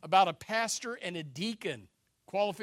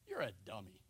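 muttered insult; 10 semitones lower, 10 dB quieter, faster than usual. Real, not acted. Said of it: "I should not use words like that".

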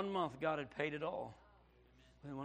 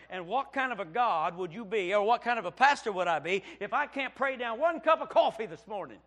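louder and higher, building to a cry; pleading prayer; 5 semitones higher, 9 dB louder than usual. Retold or imitated. Student begging God for a dime, building to a cry. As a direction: pray it out, climbing.

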